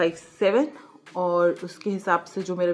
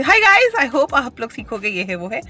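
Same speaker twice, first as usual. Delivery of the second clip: rattled off, much higher and louder; mock rushed intro; 7 semitones higher, 9 dB louder than usual. Shown as a demonstration. Vlogger mocking her own hurried intro, in a rushed high gabble. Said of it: "जैसे मैं जल्दी जल्दी बोलती".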